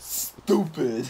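"stupid" with a long, leaning s at the front; mock-dumb, drawn-out "stupid"; exaggerated "stupid". Shown as a demonstration. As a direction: overdo the word "stupid" in a put-on voice. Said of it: "The way you just said stupid".

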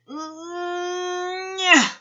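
very long, high nasal-hummed strain; high drawn-out squeal of effort; straining effort noise. A narrator's reading. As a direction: hold the strain, then burst. Keith strains on hot rock.

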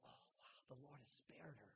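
soft whispered wonder; hushed awe. Acted. Spies marveling Rahab was spared, a soft awed whisper. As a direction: breathe it out in amazement. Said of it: "And they said".

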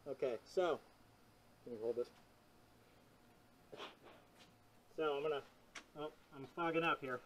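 muffled behind rubber mask; breathing inside sealed respirator; through a gas mask. Real, not acted. Seen 0: Gas mask covers face and mouth throughout the labelled words.

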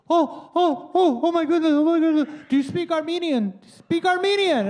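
high, loud frantic pleading woman; hammed-up panicked lady. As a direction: imitate a panicked stranger blurting questions.

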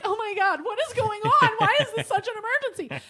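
high voice, laughing in disbelief; bewildered laughing. Retold or imitated. Confused about the emergency, high laughing disbelief. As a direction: laugh through confused panic. Bewildered reaction mid-crisis.

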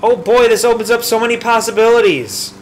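high, loud mock delight; gleeful excitement. Friend learning that cows can suck, gleeful excitement.

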